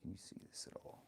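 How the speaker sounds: quick unvoiced whisper aside; hushed aside to chat. Real, not acted. Whispering a quick aside to the viewers.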